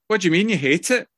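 incredulous, quick and loud; outraged at the wife's verdict; indignant disbelief. Retold or imitated. Retold outraged reply to wife.

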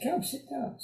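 a hushed, kind lady's welcome; warm lady. Retold or imitated. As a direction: welcome the newcomer tenderly. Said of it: "just a warm lady".

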